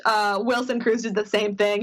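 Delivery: ordinary delivery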